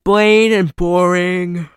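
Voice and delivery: slow words stretched by a yawn; drawn-out yawn on the words. Real, not acted. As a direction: talk through a yawn. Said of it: "I'm sorry, I'm yawning".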